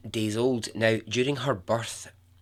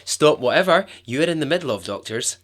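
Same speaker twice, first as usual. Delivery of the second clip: hammed-up frantic demand, raised pitch; mock demanding parent; 4 semitones higher, 6 dB louder than usual. Imagined parent ordering hospital doctors about, loudly demanding. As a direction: play an unreasonable parent making a scene. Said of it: "I'd be the worst human being".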